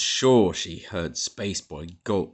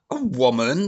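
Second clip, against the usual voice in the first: louder rising splutter; 6 semitones higher, 7 dB louder than usual. A narrator's reading. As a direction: splutter in outrage. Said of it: "spluttered the Major".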